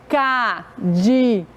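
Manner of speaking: slow "cadeado" as four separate pieces; syllable by syllable. Shown as a demonstration. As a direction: count out ca, de, a, do in "cadeado". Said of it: "Quantas vezes fizemos o movimento?".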